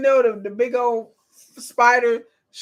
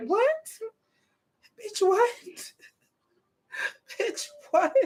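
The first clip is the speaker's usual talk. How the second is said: high incredulous what, then breaking into laughter; can't believe what she just heard; squeaky outraged what dissolving into a laugh; shocked disbelief; 10 semitones higher, 3 dB quieter than usual. Real, not acted. Disbelief at the character.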